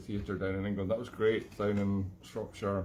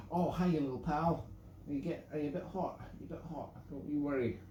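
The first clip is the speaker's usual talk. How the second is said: tender pet talk, softer on the questions; talking to the dog; 5 semitones higher, 3 dB quieter than usual. Real, not acted. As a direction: coo gently to a hot little dog.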